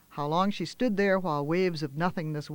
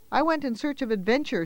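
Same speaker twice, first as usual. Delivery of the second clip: blurted; 7 semitones higher than usual. Acted. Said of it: "she blurted".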